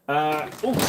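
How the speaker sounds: ordinary talk, nothing marked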